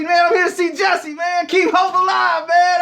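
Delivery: hyped fan yelling greeting; excited shout. Retold or imitated. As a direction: call out to him across the airport. Shouting at Jesse Jackson.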